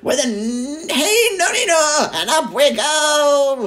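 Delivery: put-on a wild old witch crowing with glee; screechy old grandma shout. A narrator's reading. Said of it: "she shouted".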